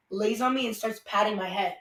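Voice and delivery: ordinary talk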